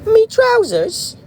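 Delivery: very high, squeaky mock British accent. Echoing the trousers line, in high-pitched mock British. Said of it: "speak British now".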